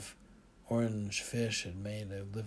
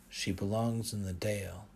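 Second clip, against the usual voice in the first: ordinary delivery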